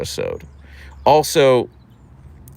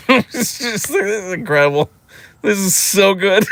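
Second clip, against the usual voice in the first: laughing delight; 8 semitones higher than usual. Retold or imitated. Delight at being spat on.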